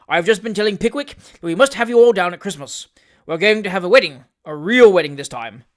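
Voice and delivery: hearty and consoling, a bit loud; hearty old gentleman. A narrator's reading. Wardle announcing a wedding.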